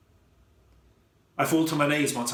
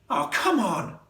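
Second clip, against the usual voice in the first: frustrated; 7 semitones higher, slower than usual. A narrator's reading. Frustrated at failed trap.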